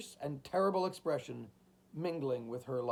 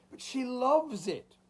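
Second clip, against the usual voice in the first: high, slow anguished plea; agonized entreaty; 9 semitones higher than usual. A narrator's reading. Old man entreating the chimes for his daughter, agonized. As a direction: plead in agony, drawn out. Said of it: "in agonized entreaty".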